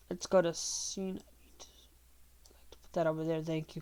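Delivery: quick unvoiced whispered aside; whispering. Real, not acted. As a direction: whisper it under your breath off to the side. Aside while moving things off-camera.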